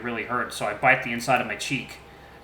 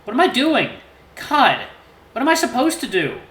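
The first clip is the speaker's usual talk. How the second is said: high, louder anguished questions; despairing; 11 semitones higher, 6 dB louder than usual. A narrator's reading. Fox alone in the woods, anguished and despairing. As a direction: cry out in helpless despair.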